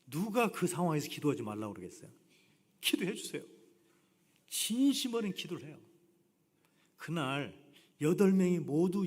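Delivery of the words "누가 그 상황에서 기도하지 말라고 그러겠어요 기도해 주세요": usual speaking voice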